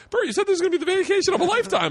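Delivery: high, rushed imitation of alarmed travelers; mock panicked complaint. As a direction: whine it fast and very high, like a frightened tourist. Imitates worried travelers during fight.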